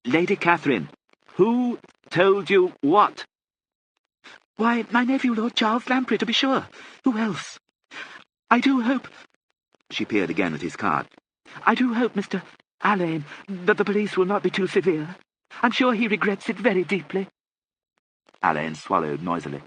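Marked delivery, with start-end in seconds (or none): 0.0-3.2 high, loud, drawn-out question; loud for the deaf. A narrator's reading. Questioning a deaf old lady. 4.6-9.1 very high-pitched aristocratic lady; deaf old lady. A narrator's reading. 11.6-17.3 very high-pitched aristocratic lady; deaf old lady. A narrator's reading.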